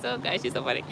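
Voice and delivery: ordinary talk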